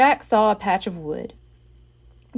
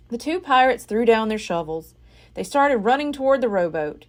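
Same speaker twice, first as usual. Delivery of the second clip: ordinary delivery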